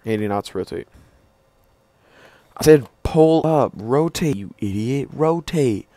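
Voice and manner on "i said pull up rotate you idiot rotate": loud frustrated order; angry yell. Pretend. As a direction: bark rotate at the idiot. Yelling at pilot to rotate.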